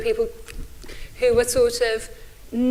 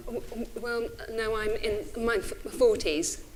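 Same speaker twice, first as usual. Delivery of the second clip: stutter; 8 dB quieter than usual. Real, not acted. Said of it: "I have a, a, a stutter".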